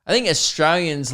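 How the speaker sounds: normal talking voice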